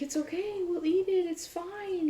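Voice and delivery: high, gentle reassurance as the husband; husband reassuring her. Retold or imitated. Husband calming his stressed wife, voiced high and gentle. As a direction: soothe her like a sweet husband.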